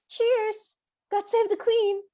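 high, bright mock British cheer; compulsive British blurt. Lightly hammed Brit blurting a toast at a stranger. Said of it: "Tessa almost compulsively goes".

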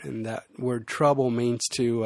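usual speaking voice

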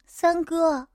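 a touch of a tearful cry; tearful call. A narrator's reading. Woman calls to her protector, tearful. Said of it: "哭着喊了声".

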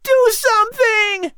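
high, desperate cry for help; girls screaming. A narrator's reading. Girls begging the boys to save Patrick, high scream. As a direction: shriek it in panic. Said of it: "the girls screamed".

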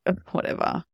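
hissed, scornful "whatever"; rude snarl. Pretend. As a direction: spit it out rudely under your breath. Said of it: "a rude snarl or a dismissive tone".

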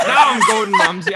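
loud, high British attempt; hammed-up British accent through laughter; Gordon Ramsay. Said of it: "Australian to British".

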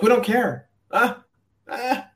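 high-pitched, uncaring brush-off; mock dismissive. Caller mimics embassy staff hanging up on him, mock dismissive.

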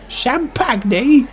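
"Champagne" echoed high as sham-pag-nay, rising; questioning mispronunciation. Acted. Diner querying the waiter's word, "Champagne" echoed as sham-pag-nay. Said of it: "It's just you mispronouncing it".

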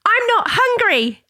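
put-on very high, drawn-out little-girl announcement; imitated daughter. Daughter bursting in with her cousin, imitated high and drawn out. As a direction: burst out slowly in a high child's voice.

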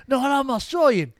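put-on Australian accent. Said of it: "Yeah, he goes".

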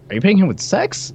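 rising, teasing disbelief; jokingly scandalized pitch jump; mock-scandalized tease. Real, not acted. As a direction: needle him about the slip. Teasing a misspoken phrase.

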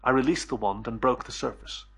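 plain reading voice

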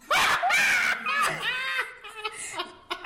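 high shouting laugh. Real, not acted. Friend bursts out laughing.

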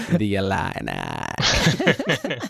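slow, drawn-out Texas drawl; Texas accent. Retold or imitated. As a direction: stretch it out like a Texan golfer. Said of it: "he says in just the most amazing Texas accent".

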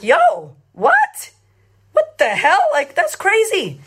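incredulous high squeak on "what"; retold disbelief. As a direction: act out being stunned, pitch leaping up. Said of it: "So I'm like".

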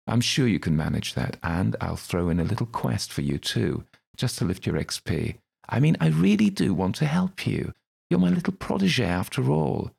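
high, sly gentleman's voice, wheedling; Crowley's voice. Acted. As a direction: purr it like a smug patron.

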